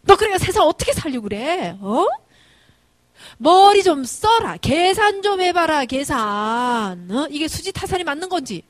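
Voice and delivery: loud, high scolding with stretched words; scolding yell. Retold or imitated. Mother berating her son over a bad money deal, scolding yell. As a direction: yell in exasperation, dragging out the words. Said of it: "이제 소리를 지르죠".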